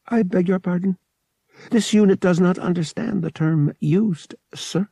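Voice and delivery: high, evenly measured robot voice; uncomprehending droid. A narrator's reading. Droid fails to understand the pilot, polite and mechanical. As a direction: recite it politely, like a machine.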